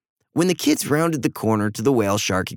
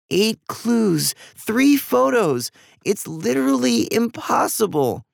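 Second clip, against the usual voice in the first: put-on high-pitched, exasperated child's protest; despairing complaint; 10 semitones higher, slower than usual. Eva despairing over eight clues and three photos, high wailing complaint. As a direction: pitch it high and hopeless, leaning on each phrase.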